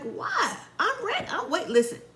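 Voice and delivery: excited reality-show viewer; eager. Real, not acted. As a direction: squeal it like a fan wanting drama. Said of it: "I was like".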